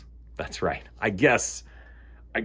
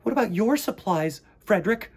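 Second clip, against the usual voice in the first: high squeaky mouse voices, a bit louder; eager little mice asking; 11 semitones higher, 6 dB louder than usual. Acted. Mice pestering Frederick, high and squeaky.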